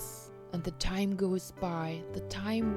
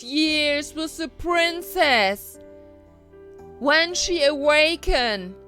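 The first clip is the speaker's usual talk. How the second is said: loud, raised proclaiming voice; good fairy's spell; 7 semitones higher, 9 dB louder than usual. A narrator's reading. Good fairy puts the whole castle to sleep, proclaiming. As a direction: cast the spell in high, ringing tones.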